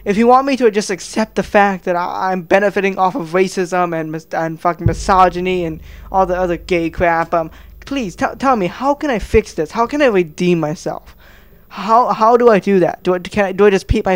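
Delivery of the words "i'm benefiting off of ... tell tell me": normal talking voice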